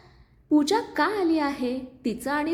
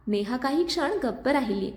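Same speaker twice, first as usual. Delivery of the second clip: ordinary delivery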